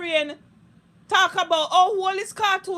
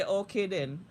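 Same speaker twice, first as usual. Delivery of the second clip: mock calm; 8 semitones lower, 8 dB quieter than usual. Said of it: "me say".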